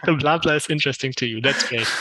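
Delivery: real amusement, just after a laugh; tickled by a viewer's comment; amused. Real, not acted. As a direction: talk on through the tail of a laugh. Amused reply right after laughing.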